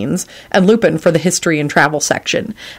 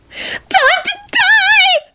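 maniacal, very high drawn-out shriek; joyful shriek; much slower than usual. A narrator's reading. Loretta leaping in to attack, gleeful shriek. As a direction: shriek it with mad glee. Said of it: "she shrieked joyfully".

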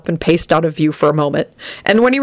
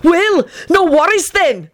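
high, drawn-out sarcastic retort; high-pitched exasperated comeback; shouted back; 12 semitones higher, slower than usual. A narrator's reading. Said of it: "Benji shouted back".

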